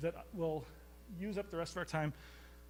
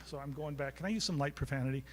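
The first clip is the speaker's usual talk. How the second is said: ordinary talk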